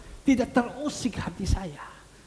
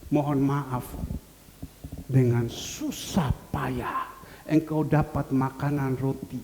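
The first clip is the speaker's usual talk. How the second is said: ordinary talk, nothing marked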